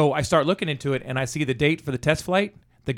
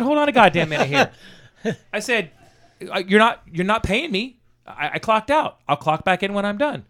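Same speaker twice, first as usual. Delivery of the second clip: mock indignant outburst; 8 semitones higher than usual. Retelling to a friend how he told off his boss, higher and laughing. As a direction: play the outraged employee with a laugh in it.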